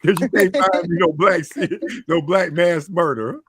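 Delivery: ordinary delivery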